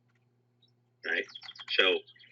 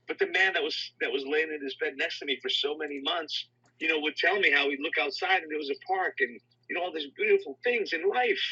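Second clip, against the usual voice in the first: higher, bewildered, pleading; retold patient struggling to understand, higher; confused patient; 8 semitones higher than usual. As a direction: voice the man's disbelief, pitched higher. Patient explains his lost neighbour.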